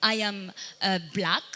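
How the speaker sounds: ordinary talk, nothing marked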